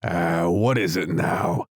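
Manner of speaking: ordinary delivery, nothing marked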